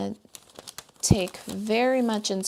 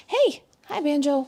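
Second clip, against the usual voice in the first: higher, louder gushing hello to the dog; light up as the dog arrives; excited pet greeting; 6 semitones higher, 6 dB louder than usual. Real, not acted. Greeting the dog Banjo as he walks in, high excited pet voice.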